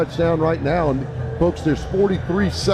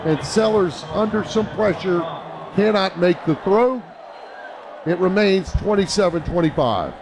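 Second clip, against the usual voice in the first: fast, raised radio play-by-play; live play-by-play; 5 semitones higher than usual. Real, not acted. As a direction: call the play as it happens.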